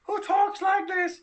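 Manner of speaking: very high, slow, squeaky put-on voice; Nerdy Jesus impression. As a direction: mimic a friend's silly high voice, drawn out. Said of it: "my terrible impression".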